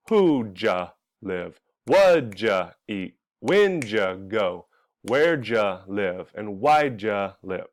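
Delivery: "who ja live", "what ja eat" said slowly, "ja" blended, verb stressed; slow drill stressing the verb after "ja". Shown as a demonstration. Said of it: "there's two sounds".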